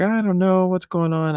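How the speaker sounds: lightly voicing his upset daughter; daughter quoted. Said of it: "She's like".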